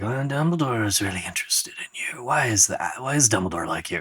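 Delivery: quiet, half-whispered probing; muffled conspiratorial whisper; sly, soft Graves. Retold or imitated. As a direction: lean in and whisper the question. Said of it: "Graves in passing says".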